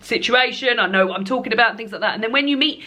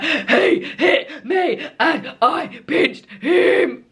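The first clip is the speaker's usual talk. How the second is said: higher, very slow, mock sobbing schoolchild; childish crying; 6 semitones higher, much slower than usual. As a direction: imitate a little kid whining through tears, laughing. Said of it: "the crying, like".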